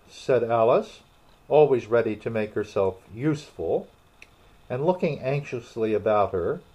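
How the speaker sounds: plain reading voice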